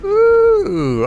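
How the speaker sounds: usual speaking voice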